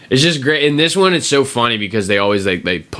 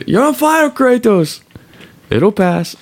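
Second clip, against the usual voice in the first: high, loud squeaky imitation of the sidekick; 4 dB louder, slower than usual. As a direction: squeak it out, mocking the little buddy. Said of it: "And this one, he says".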